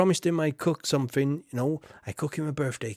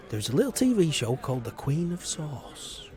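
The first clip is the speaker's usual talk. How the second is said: usual speaking voice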